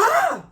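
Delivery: high, loud startled shriek; startled scream. Retold or imitated. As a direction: yelp as if someone crept up behind you. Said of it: "She scared the shit out of me".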